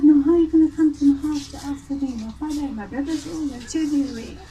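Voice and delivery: lively acted-out remonstrance; retold protest. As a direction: imitate a woman warning off her suitor. Mother's alarmed warning to suitor, retold.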